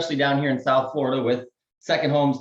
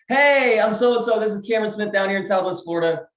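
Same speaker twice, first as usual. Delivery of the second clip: peppy sales-video greeting; upbeat opener; 6 semitones higher than usual. Shown as a demonstration. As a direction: open brightly, like greeting a new lead on video. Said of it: "I always start it off like this".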